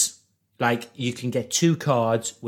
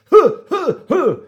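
out of breath, hard puffs; huffing like he just ran in; panting; 14 semitones higher, 9 dB louder than usual. Real, not acted. Panting as he bursts in to tell the story.

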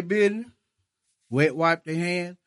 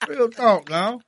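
ordinary talk, nothing marked

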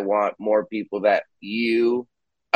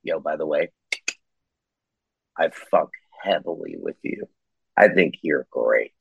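sincere low voice; 8 semitones lower than usual. Retold or imitated. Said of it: "where I'm like".

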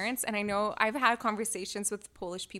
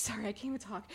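usual speaking voice

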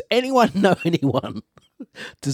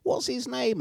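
mock coworkers; 7 dB quieter than usual. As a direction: imitate coworkers cooing in a high voice. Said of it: "I'd say".

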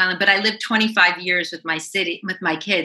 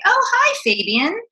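bright greeting; 13 semitones higher than usual. Retold or imitated. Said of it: "I heard someone say".

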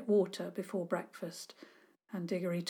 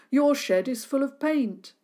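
accusing; 6 semitones higher, 6 dB louder than usual. Acted. Kids accusing neighbour.

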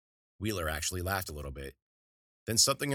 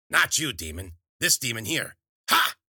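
witch correcting the cat; 4 semitones higher, 8 dB louder than usual. A narrator's reading.

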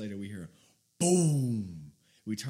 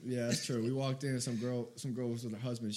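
ordinary talk

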